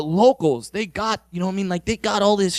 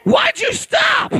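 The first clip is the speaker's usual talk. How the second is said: stretched high-pitched whine; high slow mock woman; much slower than usual. Comedian voicing a woman asking why he stopped. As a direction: drawl it out high like the disappointed woman.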